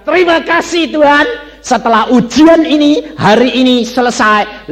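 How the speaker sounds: high, loud thanks to God; retold thanksgiving prayer. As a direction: proclaim your thanks at full voice. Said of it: "Saya bilang".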